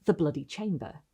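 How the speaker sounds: usual speaking voice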